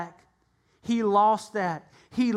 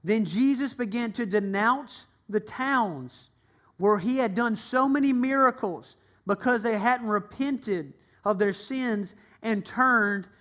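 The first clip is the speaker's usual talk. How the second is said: usual speaking voice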